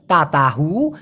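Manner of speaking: low "তা তাহু" without k, rising; colloquial pronunciation. Shown as a demonstration. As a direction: drop the k and lengthen "তাহু". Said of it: "মালয়েশিয়ানরাও তাই বলে".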